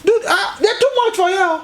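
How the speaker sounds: high, laughing, overjoyed; delighted man laughing. Retold or imitated. Urobo man urges the narrator to drink tea, laughing and high. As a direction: gush with laughter-laced delight, pitched high. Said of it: "He said".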